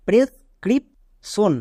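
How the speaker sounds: Prescription said very slowly, syllable by syllable; teacher modelling a hard word; slow model word. Shown as a demonstration. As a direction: draw out prescription for the class. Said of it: "কেন আমি একে এভাবে কেন উচ্চারণ করলাম".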